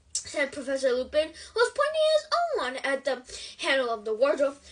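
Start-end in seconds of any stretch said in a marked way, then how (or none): none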